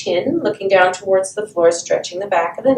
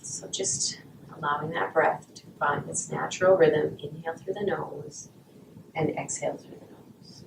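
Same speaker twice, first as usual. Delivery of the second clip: guided meditation; 3 semitones lower than usual. Acted. Guided breathing at class start.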